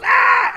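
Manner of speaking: high startled yelp, hammed-up; mock scream. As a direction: squeal like people fleeing a bear.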